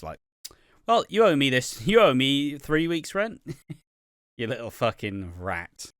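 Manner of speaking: normal talking voice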